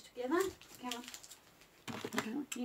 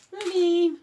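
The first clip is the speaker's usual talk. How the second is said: loud, high call of the name; 7 semitones higher, 18 dB louder than usual. Real, not acted. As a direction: grab the dog's attention with a loud call. Calling dog's name.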